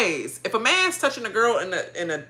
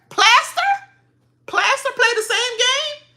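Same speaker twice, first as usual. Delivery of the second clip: high, incredulous question. Real, not acted. Reacting to Joseph's claim, incredulous. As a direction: ask in total disbelief.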